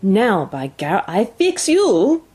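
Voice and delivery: put-on slow threat, rising high in a French-Canadian accent; irate French-Canadian dog driver; angry cry. A narrator's reading. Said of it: "Francois was angry".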